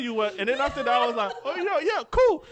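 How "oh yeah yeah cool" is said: excited agreement. Retold or imitated. Retold own delighted agreement.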